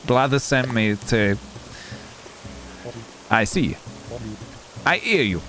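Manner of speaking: lightly voiced Italian-British uncle; soft Italian accent with British leanings. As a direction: talk like an Italian uncle living in Britain. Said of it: "my best impression of my Uncle Tony".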